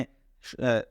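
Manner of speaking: ordinary talk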